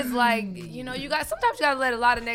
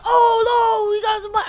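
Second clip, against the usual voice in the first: high, loud thrilled exclamation; excited; 10 semitones higher, 6 dB louder than usual. Retold or imitated. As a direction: gush like a stunned fan. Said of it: "he was like".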